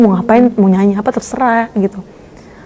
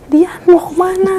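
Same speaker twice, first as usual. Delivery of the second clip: retold shout; 9 semitones higher than usual. Papa calls after fleeing Dian, retold high-pitched shout. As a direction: imitate a parent calling out from afar.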